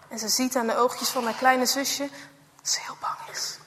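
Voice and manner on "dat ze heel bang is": whispered, almost no voice; scared whisper. A narrator's reading. Narrator whispers about the frightened little sister. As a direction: let the fear hush it.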